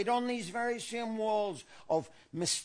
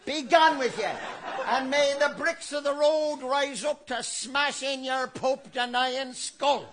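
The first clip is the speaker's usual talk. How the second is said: high, loud mock yell of an Irish bar mob; mock screaming; 8 semitones higher, 3 dB louder than usual. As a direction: bellow it like a furious drunk in a pub. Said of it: "All the while screaming".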